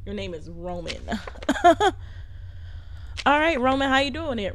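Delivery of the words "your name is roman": ordinary delivery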